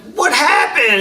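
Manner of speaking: high, loud worried mom; upset mom. Retold or imitated. A mother's loud alarmed cry. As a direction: mom after police bring the kid home, asking loudly. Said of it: "your mom's like".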